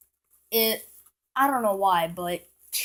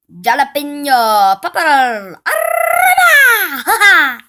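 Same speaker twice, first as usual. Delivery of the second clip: hammed-up Spanish accent; 7 semitones higher, 14 dB louder than usual. Kid announcing a firework's name to viewers, hammed-up Spanish accent shout. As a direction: belt it out with a theatrical Spanish accent.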